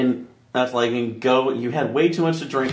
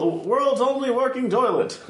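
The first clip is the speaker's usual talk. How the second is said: tour guide voice; 9 semitones higher, slower than usual. Pretend. Mock tour guide announcing a wonder.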